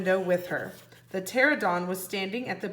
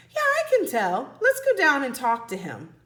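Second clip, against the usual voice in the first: high, louder eager voice; eager girl; 5 semitones higher, 5 dB louder than usual. Acted. Annie urging Jack to go down, high and eager. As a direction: bubble with eagerness.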